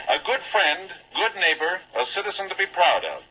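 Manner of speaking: usual speaking voice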